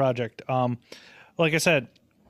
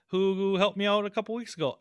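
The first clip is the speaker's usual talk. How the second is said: usual speaking voice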